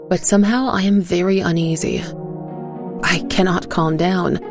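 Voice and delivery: plain reading voice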